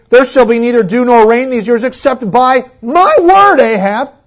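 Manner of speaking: loud, high, commanding prophet's decree; bold proclamation. Retold or imitated. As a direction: declare it boldly to the king. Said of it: "so bold as to say".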